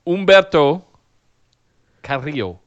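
Humberto Carrillo with Spanish vowels and a trilled r in Carrillo; rolled r. Shown as a demonstration. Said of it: "I rolled my R's".

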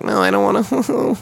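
high-pitched mock whine; whining kid. Imitating own younger self refusing braces, whining. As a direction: whimper like a reluctant child.

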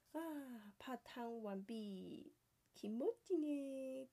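high, loud delighted sigh of relief; 気持ちよさそうに高く; blissful. A narrator's reading. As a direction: savour the feeling after the hot bath. Finishing the bath.

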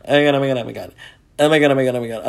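rapid repeated gushing, acted; gasp, then babble it over and over, falling away; starstruck happy. Fan who just saw a celebrity, starstruck happy. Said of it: "I was so fucking happy".

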